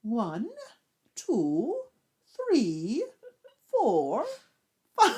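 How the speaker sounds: lightly posh English counting, each number spaced out; posh Missus Bouquet accent. Shown as a demonstration. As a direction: count slowly like a snooty English lady. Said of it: "I went Missus Bouquet".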